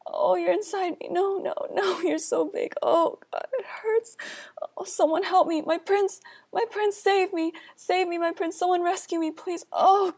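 high sobbing cries for help; crying. Acted. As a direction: sob and wail, voice pitched high. Assaulted princess crying for help.

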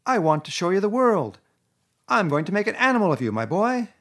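grand Toad. A narrator's reading. Toad promising Rat the world, grand. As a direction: dazzle him.

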